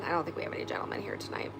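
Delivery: quick whispered aside about no gentlemen; a fast, playful whisper to the viewers. Real, not acted. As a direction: slip it in fast under your breath.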